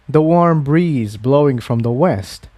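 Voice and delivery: ordinary delivery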